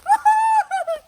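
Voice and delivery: high, loud burst of laughter; bright laugh. Real, not acted. Host laughing to the baby, high and loud.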